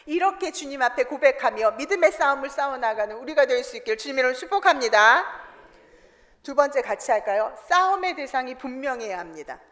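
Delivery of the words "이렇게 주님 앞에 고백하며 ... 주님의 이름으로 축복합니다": ordinary talk, nothing marked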